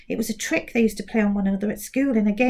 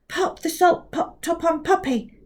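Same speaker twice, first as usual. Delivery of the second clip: careful, pitched-up precise reading; 7 semitones higher than usual. Acted. Kovac speaks precisely to the mouth. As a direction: pronounce every sound of "Pop the salt pot top on". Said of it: "tried to speak very precisely".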